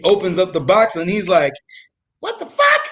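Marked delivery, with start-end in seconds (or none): 2.2-2.8 imitating an angry squeak, very high; outraged falsetto mimicry; high squeaky voice. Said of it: "this high squeaky voice".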